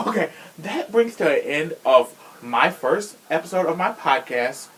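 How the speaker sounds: usual speaking voice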